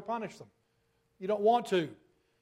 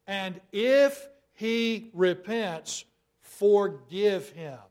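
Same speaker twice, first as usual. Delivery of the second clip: very slow, louder, IF stretched out; stress on IF; 2 semitones higher, 6 dB louder, much slower than usual. Acted. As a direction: draw out every word and lean on if. Stretched scripture condition.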